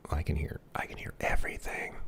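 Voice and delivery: soft whisper testing the mic. Real, not acted. Testing hot mic quietly.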